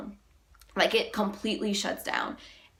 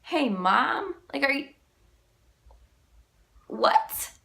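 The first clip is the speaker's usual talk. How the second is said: higher, with a questioning rise; incredulous echo; 6 semitones higher, slower than usual. Real, not acted. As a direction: echo it in disbelief, rising at the end. Incredulous echo of his greeting.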